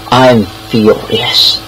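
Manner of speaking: ordinary talk, nothing marked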